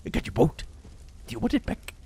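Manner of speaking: high, soft, coaxing clown voice; Pennywise. Acted. Clown offering Georgie his boat, high and soft. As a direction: tempt him with the boat. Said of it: "I can do a half-decent Pennywise".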